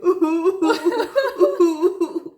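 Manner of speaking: loud high laugh; real laugh bursting out; big real laugh; burst of laughter. Real, not acted. Laughs at crying face.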